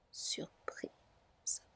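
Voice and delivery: plain reading voice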